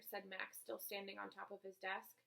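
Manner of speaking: usual speaking voice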